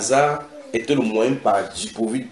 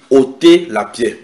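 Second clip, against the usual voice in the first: loud, slow order; commanding Jesus; 2 semitones higher, 9 dB louder, slower than usual. Retold or imitated. Preacher as Jesus ordering the stone removed, commanding. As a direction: give a firm, measured command. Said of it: "Jésus dit".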